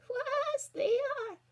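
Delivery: very high, loud fake granny; old lady's voice. A narrator's reading. Wolf in bed pretends to be Granny, put-on old lady. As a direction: call out in a squeaky mock old-lady voice. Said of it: "put on his best old lady's voice".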